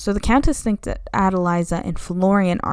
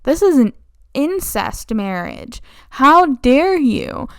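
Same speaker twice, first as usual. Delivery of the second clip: louder, slow indignant how dare you; hammed-up scolding; mock outrage; 5 semitones higher, 5 dB louder, slower than usual. Countess scolding the incestuous couple, mock outrage.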